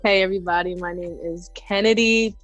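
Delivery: normal talking voice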